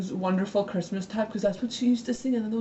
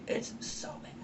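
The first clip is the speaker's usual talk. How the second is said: whispered aside; 11 dB quieter than usual. Real, not acted. Self-deprecating aside about singing.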